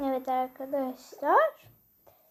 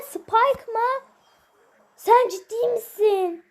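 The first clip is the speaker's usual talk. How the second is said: disbelief; 5 semitones higher, 6 dB louder, slower than usual. Real, not acted. Unexpected character from box.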